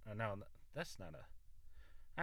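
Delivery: normal talking voice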